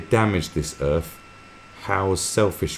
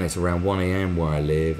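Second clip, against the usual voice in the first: ordinary talk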